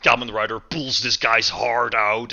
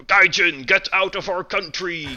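higher-pitched imitation of megaphone shouting; mock screaming protester; 6 semitones higher than usual. Imitating a protester screaming anti-Kaijin slogans into a megaphone, mock yell. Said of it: "a guy with a megaphone just screaming".

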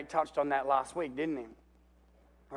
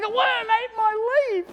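much higher, slow sulky whine; whiny Jonah; 7 dB louder than usual. Acted. Preacher mocks Jonah sulking over his leaf, in a high slow whine. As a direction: play Jonah as a sulking complainer, high and drawn out. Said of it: "That's Jonah".

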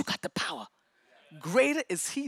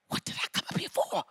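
confused whisper; 6 dB quieter than usual. Retold or imitated. Confused muttering on stairs.

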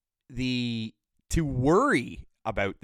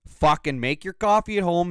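normal talking voice

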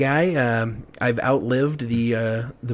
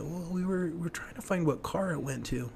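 higher, soft and hesitant retold excuse; sheepish excuse; 5 semitones higher, 9 dB quieter than usual. Kid explaining himself to quizzing parents, sheepish. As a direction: retell it as a halting, quiet cover story.

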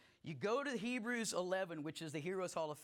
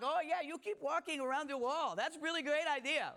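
high, sarcastic cartoon mockery; mocking French peas; 7 semitones higher, 4 dB louder than usual. Pastor mimics the mocking French peas for the congregation. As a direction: sneer it like a cartoon pea. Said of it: "the French peas are mocked".

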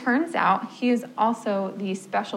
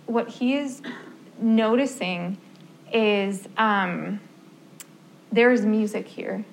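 normal talking voice

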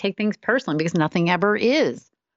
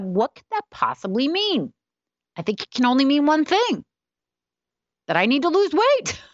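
incredulous inner thought; 10 semitones higher than usual. Retold or imitated. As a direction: talk yourself into the worst reading, climbing up. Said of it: "and I thought".

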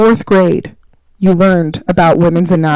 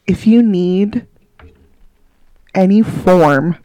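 mock serious; 3 semitones lower than usual. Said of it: "we're gonna get serious".

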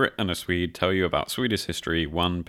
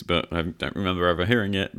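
ordinary talk, nothing marked

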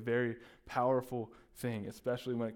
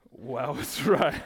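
real laugh, louder; 2 semitones higher, 9 dB louder, slower than usual. Real, not acted. Pastor laughs at his own name joke, loud laugh.

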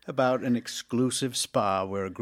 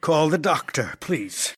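urgent plea; 4 semitones higher, 8 dB louder than usual. Acted. Man in agony asks doctor.

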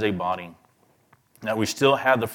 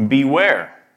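"Beware" punched higher and louder with a falling end; stressed warning; 4 semitones higher, 6 dB louder than usual. Shown as a demonstration. Preacher warning the congregation, a loud stressed "Beware". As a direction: hit "Beware" as a sharp warning. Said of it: "So he's saying beware".

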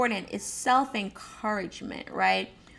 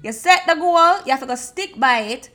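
higher, loud Jamaican patois, firm; 6 semitones higher, 7 dB louder than usual. Retold or imitated. Jamaican friend telling the listener to stick to the goal, in a loud, high Jamaican voice. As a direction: push them hard in a Jamaican accent. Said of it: "Jamaica will say".

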